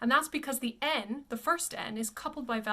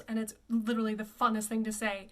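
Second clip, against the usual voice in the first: ordinary talk, nothing marked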